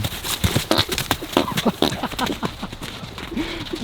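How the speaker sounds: breathy wheezing laugh; helpless silent giggling; real laugh. Real, not acted. Laughing as the horse farts on her.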